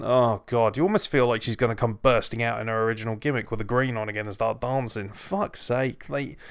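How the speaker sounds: ordinary talk, nothing marked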